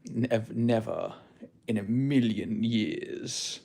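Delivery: hammed-up narcissist, swelling to a proud peak; vain self-maxer impression. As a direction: brag like a self-adoring guru. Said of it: "the vibes I get from that guy's channel".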